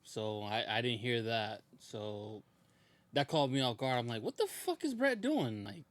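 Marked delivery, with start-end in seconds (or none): 4.2-5.6 high, drawn-out disbelief; retold bafflement. Viewer caught off guard by Bret, high-pitched disbelief. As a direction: blurt it slowly in baffled pitch.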